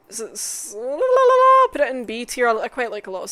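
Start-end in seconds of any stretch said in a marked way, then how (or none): 0.8-1.7 high, loud la-la-la babble. Real, not acted. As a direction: trill la-la-la loudly up high. Breaks into nonsense syllables.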